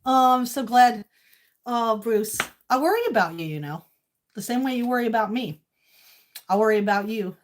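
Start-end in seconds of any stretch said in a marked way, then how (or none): none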